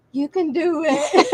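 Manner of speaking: high, slow, drawn-out cheering on; laughing encouragement. Retold or imitated. Helper comes over to flustered sewer, laughing mock encouragement. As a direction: imitate a helper's pep talk through laughter.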